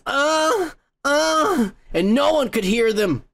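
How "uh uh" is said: higher-pitched mock grunts; short, raised "uh" grunts, imitating him; imitated yelling grunts. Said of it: "screaming and yelling and his".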